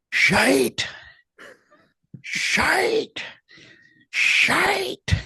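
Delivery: high, drawn-out Scottish heckle; Scottish heckler's shout. Acted. Man in the crowd heckles the act, high Scottish yell. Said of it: "I'm sorry for my Scottish accent".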